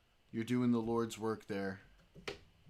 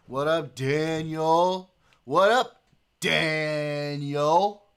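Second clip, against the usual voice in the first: high, loud, stretched-out hello; excited greeting; 4 semitones higher, 11 dB louder, much slower than usual. Real, not acted. Streamer greeting a viewer who just joined the chat, excited and loud. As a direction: hype up a new arrival.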